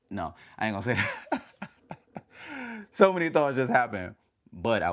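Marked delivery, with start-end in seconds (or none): 1.9-2.9 high, softer real laugh. Real, not acted. Streamer holding back what they nearly said, laughing. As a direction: chuckle at your own slip.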